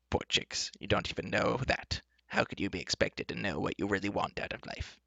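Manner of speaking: soft, lightly voiced pitying enchantress. Enchantress to the companions, mildly pitying.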